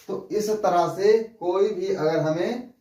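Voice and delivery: ordinary talk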